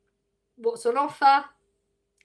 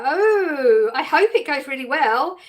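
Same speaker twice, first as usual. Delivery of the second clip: higher and louder, genuinely thrilled for them; excited good wishes; 3 semitones higher, 8 dB louder, slower than usual. Real, not acted. Streamer cheering on a viewer starting work, excited. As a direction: burst out with delighted encouragement. Wishing viewer luck on new job.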